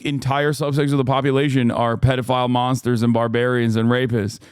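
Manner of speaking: ordinary talk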